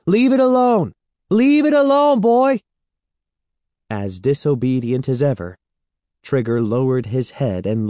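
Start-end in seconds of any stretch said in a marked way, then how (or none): 0.0-2.6 high loud command to the dog; urgent shout. A narrator's reading. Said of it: "Evan called".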